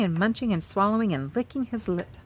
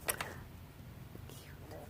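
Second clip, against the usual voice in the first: whispered cute; 22 dB quieter than usual. Real, not acted. Admiring picture.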